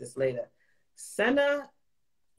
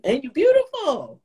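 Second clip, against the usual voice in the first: high, loud, delighted praise; real, high-pitched burst of affection; gushing compliment; 14 semitones higher, 7 dB louder than usual. Real, not acted. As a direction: gush it out to hype up a friend.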